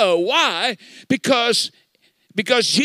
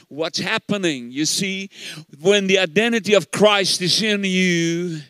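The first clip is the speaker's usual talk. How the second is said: normal talking voice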